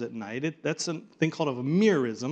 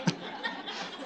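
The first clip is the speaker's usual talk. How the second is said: soft laugh; 12 dB quieter than usual. Real, not acted.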